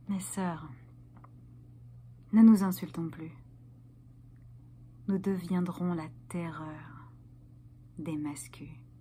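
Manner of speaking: low, near-whispered vow; conspiratorial hush. Acted. Feminist performer rallying her sisters, in a low hush. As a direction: confide it gravely, with long pauses.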